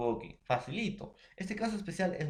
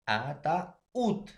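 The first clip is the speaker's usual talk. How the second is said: "ataúd" split, with weight on the final úd; stress on the Ú. Shown as a demonstration. Teacher showing the strong vowel, "ataúd" stressed on ú. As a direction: lean on the ú in "ataúd", held apart from ata. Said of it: "la mayor fuerza de voz lleva en la U".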